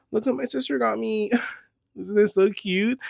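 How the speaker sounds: high excited gushing; gushing to YouTube about a cute bag. Real, not acted. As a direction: squeal over the gift. Showing off a cute gift.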